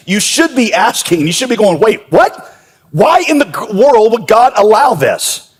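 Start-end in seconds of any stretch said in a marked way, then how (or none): 1.8-5.5 high-pitched incredulous question; baffled, stretched-out demand for an explanation; baffled protest. Acted. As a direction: question it in acted disbelief, voice jumping up. Voicing a baffled reader's protest.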